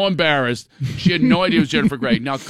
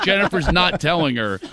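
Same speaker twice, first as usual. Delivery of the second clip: usual speaking voice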